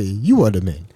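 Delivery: low-pitched African man, imitated; African accent. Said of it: "he always say".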